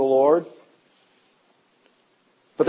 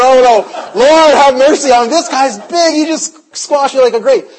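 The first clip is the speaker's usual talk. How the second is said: louder, higher mock alarm at the huge visitor; half-joking fear, pitched up and louder; mock panic; 6 semitones higher, 6 dB louder than usual. Pastor recalling his fear of the huge hockey player, louder and higher.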